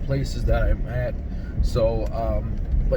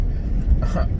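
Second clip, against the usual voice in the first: short laugh. Real, not acted. Laughing at bumpy road joke.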